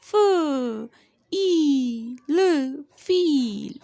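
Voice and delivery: each letter sound given separately, then blended into फील; sounding out phonics. Shown as a demonstration. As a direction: spell out फ, ई, ल, then join into फील. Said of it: "एफ का sound मैंने आपको बताया था".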